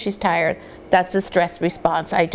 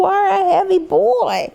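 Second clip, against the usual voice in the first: surprised delight; 10 semitones higher than usual. Real, not acted. Lifting heavy puppy.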